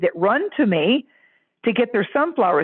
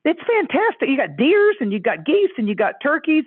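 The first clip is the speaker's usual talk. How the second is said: high, gushing neighbor; lightly voiced delighted neighbor; 8 semitones higher than usual. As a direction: rave like an impressed neighbor. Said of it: "The neighbors are like".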